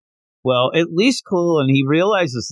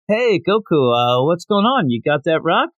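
sneaky friendly; 6 semitones higher than usual. Retold or imitated. Krillin tricking Goku for rock.

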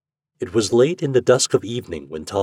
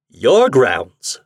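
loud, scornful sneer; 4 semitones higher, 8 dB louder than usual. Acted. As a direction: spit it back with contempt. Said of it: "said Tom with a sneer".

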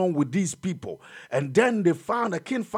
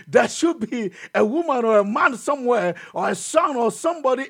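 raised preaching voice, pitched high; fiery preaching; 6 semitones higher, 4 dB louder than usual. Acted. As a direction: lift the church with hope. Preaching at full force.

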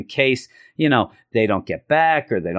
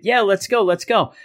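much higher, eager "let's go" repeated; excited cheer; 8 semitones higher than usual. Retold or imitated. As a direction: cheer it like a freed friend ready to leave. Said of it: "And they're all excited".

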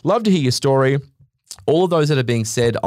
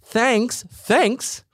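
higher, slow, mock clerk; imitating a cashier at the store, higher and slow; bored cashier; 11 semitones higher, much slower than usual. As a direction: parrot a dull checkout thanks. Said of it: "I'm getting a".

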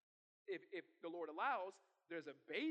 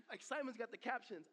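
ordinary talk, nothing marked

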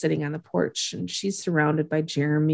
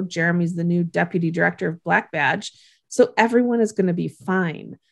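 ordinary talk

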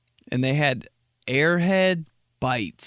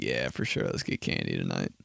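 much lower, mumbled and drowsy; sleepy stoner voice; 6 dB quieter than usual. Retold or imitated. Roommate and I planning a candy night, sleepy low mumble. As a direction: talk like you just woke up. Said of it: "exactly how we talk".

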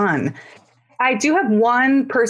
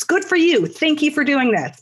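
emphatic all caps reply; 7 semitones higher than usual. Retold or imitated. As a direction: shout the congratulations like capital letters. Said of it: "all caps".

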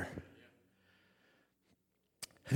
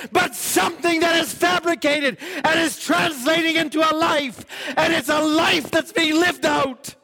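high, drawn-out preaching cry; full-force preaching; 7 dB louder than usual. Acted. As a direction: proclaim it high and slow, stretching the key words.

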